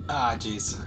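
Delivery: ordinary delivery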